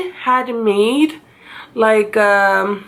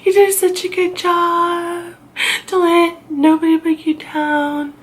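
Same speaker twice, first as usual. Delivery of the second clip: higher, sweet grateful thanks to the nurse; tearful thanks; 7 semitones higher than usual. Retold or imitated. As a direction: thank her in a high, overly sweet voice. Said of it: "I said".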